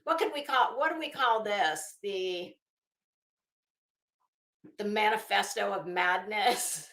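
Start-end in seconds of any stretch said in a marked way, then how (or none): none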